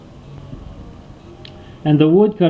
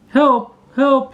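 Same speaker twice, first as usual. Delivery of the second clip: high, frightened call of a duckling stuck in ice; duckling stuck in the ice cries out in fear; cry for help; 10 semitones higher than usual. A narrator's reading. Stuck duckling calling for help, frightened. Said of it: "he cried".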